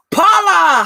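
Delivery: real delighted call-out, high and loud; excited greeting. Real, not acted. Streamer spots a friend joining live, excited greeting. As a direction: sing out the name in a high, loud burst.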